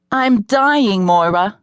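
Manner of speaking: a dramatic whiny moan of exhaustion. A narrator's reading. Exhausted alderman moans to coach Moira, dramatically.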